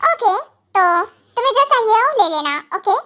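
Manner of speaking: ordinary delivery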